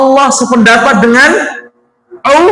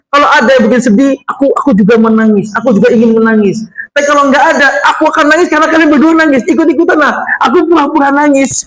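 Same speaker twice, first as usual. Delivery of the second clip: retold Umar question; 6 semitones higher, faster than usual. Retelling Umar's question, raised.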